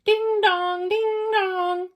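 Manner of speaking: high ringing ding dong; fire bell. A narrator's reading. Fire engine racing through the streets, high bell ding dong.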